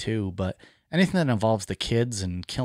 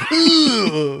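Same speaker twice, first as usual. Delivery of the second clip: imitating orgasm sound; 12 semitones higher, 6 dB louder than usual. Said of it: "And Dave's like".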